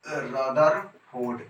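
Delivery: Rutherford stretched out very slowly; drawn out slowly. Shown as a demonstration. Teacher drilling the name Rutherford, very slow. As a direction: draw out Rutherford for the class to repeat. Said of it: "क्या बोलने का है".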